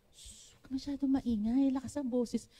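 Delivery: hushed shushing reply; shushing softly. Retold or imitated. Preacher retelling shushing Brother Joe, soft hush. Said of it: "Gaganon ako sa kanya".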